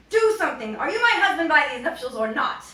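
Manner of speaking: loud high shout; furious demand. A narrator's reading. Lambra shouting at her husband to act. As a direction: command the husband in rage.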